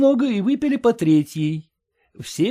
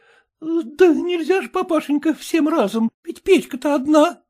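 ordinary delivery

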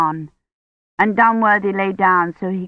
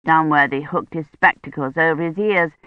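plain reading voice

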